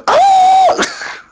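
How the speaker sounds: sudden high, loud yelp of "Ah!"; mock scream. Reading options, acts a scream.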